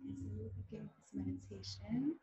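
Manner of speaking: hushed breathing cues with long pauses; guided meditation. Acted. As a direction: lead the breath softly, leaving space to inhale and exhale.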